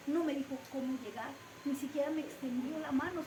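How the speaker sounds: normal talking voice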